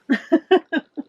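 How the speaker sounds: a short high giggle. Real, not acted. Laughing at her own beard joke, a short high giggle.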